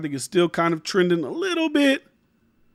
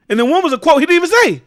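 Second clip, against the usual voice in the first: outraged; 9 semitones higher, 6 dB louder, faster than usual. Real, not acted. Outraged exclamation.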